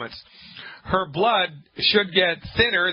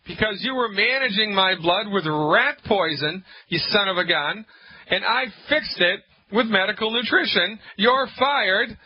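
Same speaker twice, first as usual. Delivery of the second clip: high-pitched, heated mock tirade; indignant patient; 7 semitones higher than usual. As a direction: play a fed-up patient firing the doctor. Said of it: "I want her to say".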